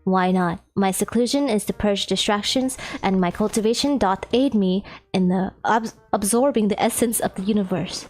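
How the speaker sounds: low, formal, grave voice; low-pitched dignified immortal; Phoenix, solemn ancient cultivator. Acted. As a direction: explain loftily in a deep archaic manner.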